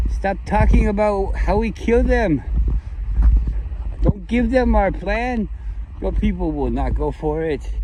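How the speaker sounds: a sneering, clumsy Israeli accent impression; mocking Israeli accent, deliberately bad. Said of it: "I don't do good accents".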